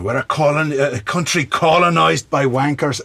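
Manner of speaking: loud, high-pitched mock Scottish accent; bad Scottish accent. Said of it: "That's a bad Scottish accent".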